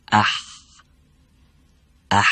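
اح with a tight catch in the middle of the throat; throat ha demo. Shown as a demonstration. Teacher to students, showing the tight throat ha. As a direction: squeeze اح from mid throat with a slight catch. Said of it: "دقت کنید باز".

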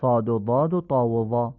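'صاد و ضاد و طا و وا' with full, dark consonants; name 'صاد' and 'ضاد' heavily; heavy Arabic letters. Shown as a demonstration. Teacher listing the same rule's letters, heavy articulation. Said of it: "و همینطور".

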